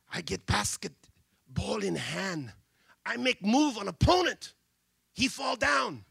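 put-on Chinese accent building to a shout; broken English. Boy telling the crowd his move, Chinese accent rising to a shout. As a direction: start slow in broken English, then shout. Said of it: "speaks broken English".

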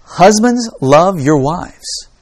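slow, drawn-out delivery starting high; emphatic reading aloud. Acted. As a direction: read it out slowly for startling effect. Reading letter aloud to startled audience.

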